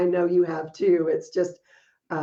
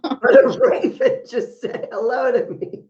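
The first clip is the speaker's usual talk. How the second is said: laughing neighbor; 4 semitones higher, 6 dB louder than usual. Retold or imitated. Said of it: "she goes".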